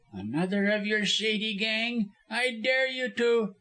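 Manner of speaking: high, sneering lawyer voice; high-pitched dare; contemptuous taunt. A narrator's reading. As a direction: goad them with rising heat.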